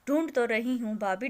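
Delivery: ordinary delivery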